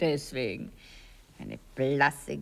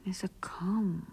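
whisper; 7 dB quieter, slower than usual. Acted. Lovers whispering in bed.

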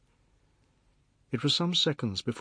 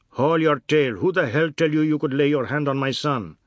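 loud, higher-pitched angry challenge; furious father; 4 semitones higher, 7 dB louder than usual. Acted. Mister Biswas confronts man who seized his son, loud anger. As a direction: tear into them, protecting your child.

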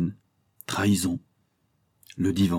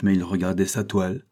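ordinary delivery, nothing marked

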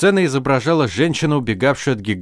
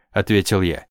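usual speaking voice

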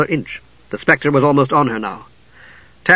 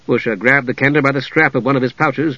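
plain reading voice

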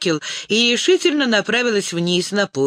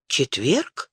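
gasp; 4 semitones lower than usual. Acted. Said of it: "ахнула Лиана".

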